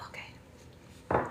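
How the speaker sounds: whispered okay. Real, not acted. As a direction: barely breathe it. Whispered to baby.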